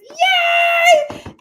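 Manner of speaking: high, loud happy shout; real joyful yell; excited. Real, not acted. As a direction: cheer for the passed license. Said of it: "so I'm excited about that".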